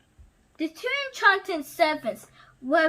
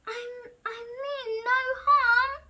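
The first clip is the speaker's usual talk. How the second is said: stammered; 8 semitones higher than usual. Acted. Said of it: "stammered Maurice".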